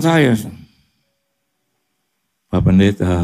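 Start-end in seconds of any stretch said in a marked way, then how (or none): 2.5-3.2 friend's troubled voice. Retold or imitated. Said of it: "suaranya udah begitu".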